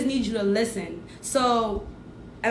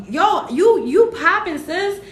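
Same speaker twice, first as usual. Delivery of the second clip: higher, louder hype for a viewer; excited hype; 6 semitones higher, 5 dB louder, slower than usual. Real, not acted. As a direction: cheer her on, yo you poppin'. Hyping up a viewer.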